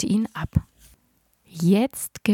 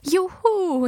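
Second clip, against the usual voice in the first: high, bright shout of joy; a child's happy whoop; cheering; 11 semitones higher, 4 dB louder than usual. Acted. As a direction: cheer like excited kids. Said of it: "jubelten wir".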